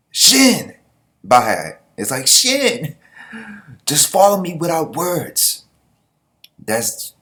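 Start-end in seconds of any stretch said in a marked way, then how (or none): 0.1-0.7 high, loud call across the field; sharp shouted call; shouting a name. Acted. Anime character calls out Shin. 2.3-5.6 shouted order. Acted. Shin's order voiced.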